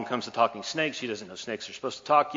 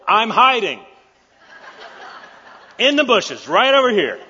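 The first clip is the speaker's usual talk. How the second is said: much higher and louder, laughing; a silly high squeaky voice owning up; sheepish Adam; 12 semitones higher, 12 dB louder than usual. Acted.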